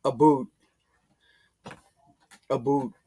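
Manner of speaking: Canadian accent. Shown as a demonstration. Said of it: "they say".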